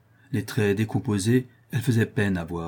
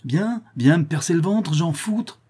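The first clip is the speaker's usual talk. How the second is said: patriot's retort; 9 semitones higher, 5 dB louder than usual. A narrator's reading. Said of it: "répondit un patriote".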